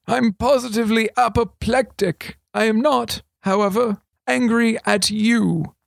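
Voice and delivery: a hint of a sly old regent. A narrator's reading. Regent confiding to thief, lightly voiced old man.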